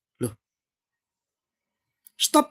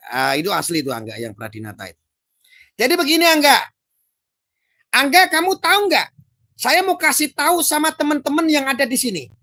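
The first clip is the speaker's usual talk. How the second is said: ordinary talk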